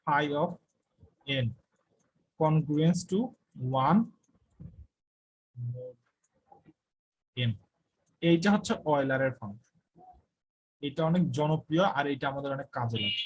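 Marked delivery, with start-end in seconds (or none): 0.0-7.5 slow dictation. Shown as a demonstration. Dictating formula to students.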